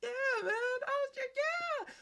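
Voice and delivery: very high, loud imitated yelp; overeager playing along. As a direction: play along far too eagerly, high and loud. Confused stranger playing along, retold.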